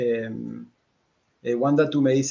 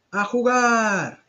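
long, high, drawn-out cheer; loud shout; 11 semitones higher, 5 dB louder, much slower than usual. A narrator's reading. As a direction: shout the call to play, stretching the last word. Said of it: "grita bien fuerte".

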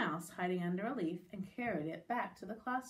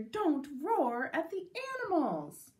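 louder, higher, emphatic telling-off; scolding teacher; 6 semitones higher, 5 dB louder than usual. Acted. Teacher to boy who roared, emphatic scolding. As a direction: scold with big swoops of pitch.

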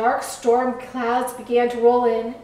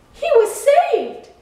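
high, loud, slow exclamation; thrilled, drawn-out storybook climax; joyful announcement; 14 semitones higher, 7 dB louder, slower than usual. A narrator's reading. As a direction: proclaim the rescue with delight. Narrating the happy rescue.

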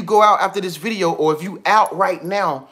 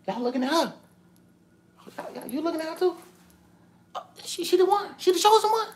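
hushed crowd gossip; 9 semitones higher, 6 dB quieter, faster than usual. Acted. Crowd voiced, whispering about her.

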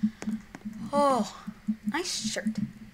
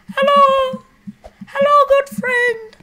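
high, slow sing-song hello; high greeting voice; 10 dB louder, slower than usual. Pretend. Gamer greeting a passing character in a squeaky high voice.

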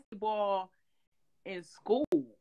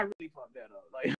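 laughing whisper; 7 semitones lower, 9 dB quieter than usual. Real, not acted. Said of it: "unvoiced laughing whisper".

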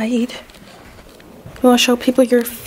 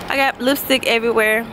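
ordinary talk, nothing marked

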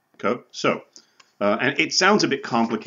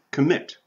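"commit" as com-MIT, pitched up; stress on second syllable; 5 semitones higher than usual. Shown as a demonstration. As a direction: set "commit" apart, lifting MIT higher. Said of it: "the second syllable".

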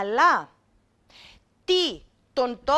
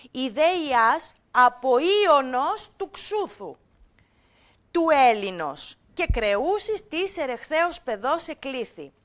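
teacher reciting a passage for students; reading ancient text; 4 dB louder than usual. Acted. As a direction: read it out like a classroom text. Reads ancient text to class.

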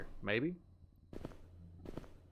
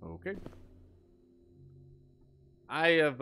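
ordinary delivery

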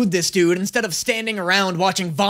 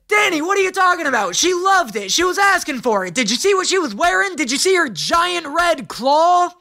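sarcastic; 9 semitones higher than usual. Pretend. Sarcastic mock defense of the villain.

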